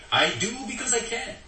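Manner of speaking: high, smug bully's claim; a boss boasting over his staff; arrogant boast. Pretend. Quoting the bully's motto.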